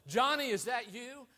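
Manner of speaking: a dad calling out through the night; father calling. Retold or imitated. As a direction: call out for a lost son.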